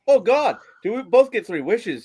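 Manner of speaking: high, eager joke voice; excited asking. Acted. Irishmen asking a genie for wishes, excited. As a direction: blurt it out in hopeful amazement.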